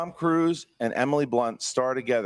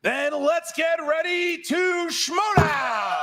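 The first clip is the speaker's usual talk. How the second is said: announcer shout; 12 semitones higher, much slower than usual. Acted. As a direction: stretch it out like a ring announcer. Host's big opening call.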